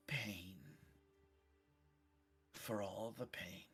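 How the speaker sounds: slow menacing whisper. Acted. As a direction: whisper it slowly like a threat. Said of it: "just chuckles and goes".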